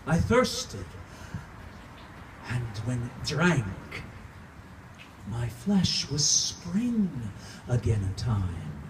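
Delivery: impassioned theatrical recitation swinging from hush to outcry; dramatic monologue. Acted. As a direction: pour it out like a tormented vampire mourning his wife. Performs own Dracula monologue.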